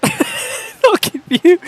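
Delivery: words squeezed out through a high laugh; giggling mid-line; cracking up while trying to speak. Real, not acted. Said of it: "I'll give you the nervous side glance".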